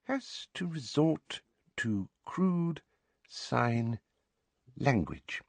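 soft, slow, high-voiced dictation to herself; word by word as if writing; halting, prim mutter with pauses; muttered note-taking. A narrator's reading. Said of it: "muttered as she made a note".

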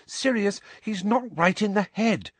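put-on high, earnest girl's voice; pleading. A narrator's reading. Hermione defending the elf to Sirius, pleading. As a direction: plead on his behalf, pitched high. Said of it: "Hermione pleaded".

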